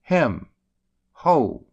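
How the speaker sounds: loud high calling out; sudden call. Shown as a demonstration. Teaching a sudden call, loud and high. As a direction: call out to someone. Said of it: "sudden call, as".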